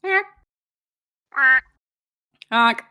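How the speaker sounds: short goose honk. Pretend. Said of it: "goose honk imitation".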